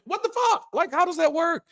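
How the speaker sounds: a high, incredulous what the fuck; baffled outburst. Real, not acted. To the congregation, an incredulous high what the fuck. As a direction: pitch shoots way up in disbelief.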